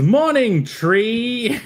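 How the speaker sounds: high, bright greeting shout; sunny young hero hailing his friend; cheery Connell voice. Acted. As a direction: call out a cheerful good morning. Voicing Connell greeting Tree.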